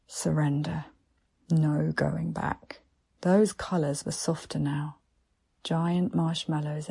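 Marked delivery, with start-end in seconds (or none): none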